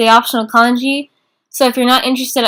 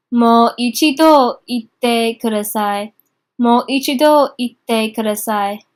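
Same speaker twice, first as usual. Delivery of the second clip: slow model phrase; slower than usual. Shown as a demonstration. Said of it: "teacher models phrase slowly".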